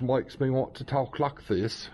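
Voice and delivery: mock posh English accent; cheesy English accent. Shown as a demonstration. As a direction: put on a hammy English accent. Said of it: "my cheesy English accent".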